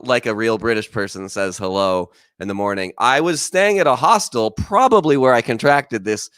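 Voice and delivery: usual speaking voice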